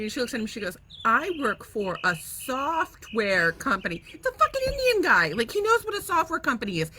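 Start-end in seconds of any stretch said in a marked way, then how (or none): 1.0-4.0 slow, over-careful mock condescension; imitating a rude guest talking down, slowly; condescending. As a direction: spell it out slowly as if he can't understand. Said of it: "she was so condescending".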